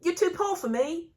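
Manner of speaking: high, loud mock-posh British accent; imitation posh English, pitched high and loud; mock posh BBC accent. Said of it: "the little posh B B C".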